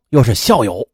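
usual speaking voice

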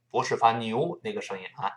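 ordinary talk, nothing marked

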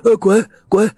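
put-on high, loud silly old-man retort; a dazed old man grinning and yelling back; foolishly laughing old man. A narrator's reading. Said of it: "老头傻笑着说". (老头傻笑着回嘴，声音高而响)

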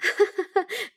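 high, light acted chuckle; acted laugh. A narrator's reading. Sister-in-law amused by the girl's question, high laugh. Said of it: "他嫂子笑道".

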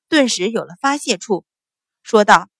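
usual speaking voice

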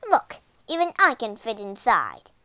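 loud, exasperated witch voice; annoyed witch. A narrator's reading. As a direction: show her, loudly and impatiently. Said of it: "the witch was very annoyed".

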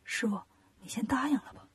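very soft hushed advice; quiet voice. Acted. Wukong quietly urging his master to agree. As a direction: murmur it under your breath. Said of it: "悟空小声说".

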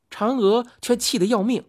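plain reading voice

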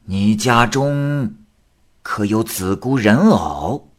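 grave questioning voice, slow; slow stern exorcist. Acted. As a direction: ask it like a knowing sorcerer. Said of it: "李成尧说".

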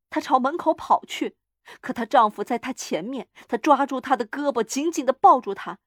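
usual speaking voice

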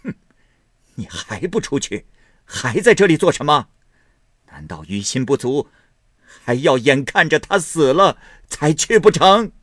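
loud, high bitter dismissal; tearful anger. A narrator's reading. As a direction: drive him out in tearful fury. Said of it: "贾母含泪说道".

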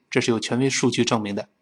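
ordinary delivery

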